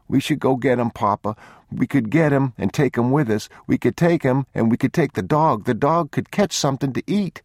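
put-on high, eager child bargaining; pleading little boy. A narrator's reading. Boy begging to rescue the other child, high pleading. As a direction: beg Papa, pitch held up. Boy begs father to return.

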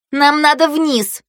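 high, slow, insistent counter-demand; militant objection. A narrator's reading. Фею backing the call to go down, militant. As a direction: drag it out combatively. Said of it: "воинственно сдвинув со лба прядь волос".

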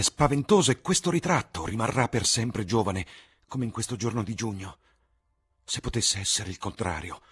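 Dorian's anguish building into a vow; young Dorian in despair. A narrator's reading. Dorian pleading over his own portrait, loud despairing young man.

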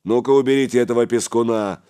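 loud, quick command; giving orders. A narrator's reading. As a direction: order your men briskly. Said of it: "распорядился Гораст".

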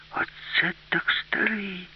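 hushed, high-pitched wonder; girl's amazed thought. Acted. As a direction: marvel to yourself, drawn out. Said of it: "подумала Аліса".

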